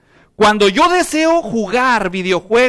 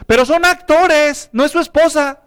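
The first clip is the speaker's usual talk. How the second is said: mock objection; 7 semitones higher, 4 dB louder than usual. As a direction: play the indignant listener who objects. Objeción imitada de oyente. (objeción burlona, aguda y lenta)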